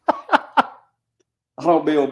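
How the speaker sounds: slow, laughing through the words; real amused laugh, slowed down. Real, not acted. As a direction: chuckle at the mix-up while talking. Laughs at the wrong form letter.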